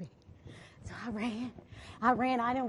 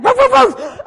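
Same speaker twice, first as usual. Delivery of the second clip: loud, raised dog barks; dog barking; 11 semitones higher, 17 dB louder than usual. Retold or imitated. The dog barks behind her as she runs, loud. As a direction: bark like a chasing dog.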